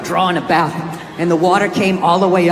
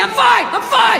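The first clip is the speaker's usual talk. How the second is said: high insistent brush-off; mock macho denial; 13 semitones higher than usual. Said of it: "as you're going down".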